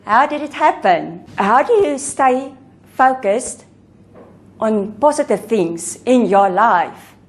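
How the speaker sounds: normal talking voice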